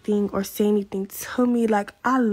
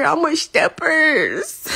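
ordinary talk

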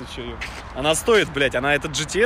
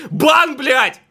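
laughing; 8 dB louder than usual. Real, not acted. Real laugh at a ban.

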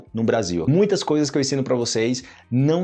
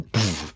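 soft burst of air for catching fire; mimicked ignition "puf"; whoosh imitation; 8 semitones lower, 4 dB quieter than usual. Shown as a demonstration. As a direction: puff out a quick flame noise.

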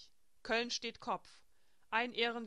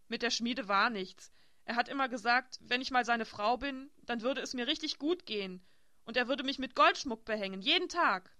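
cling to his promises; 3 semitones higher, 8 dB louder than usual. A narrator's reading. Elsbeth repeats his promises. (eifrig, zum Ende hoch und laut)

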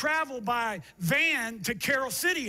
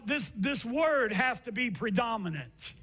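ordinary talk, nothing marked

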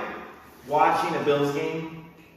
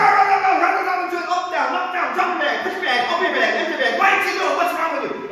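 high, loud rapid-fire boot camp orders; in-your-face drill instructor; 12 semitones higher, 4 dB louder than usual. Acted. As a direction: yell orders right in a recruit's face. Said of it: "They get in your face really quick".

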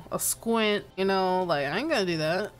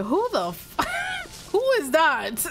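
mock boss outrage; 6 semitones higher, slower than usual. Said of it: "Boss was like".

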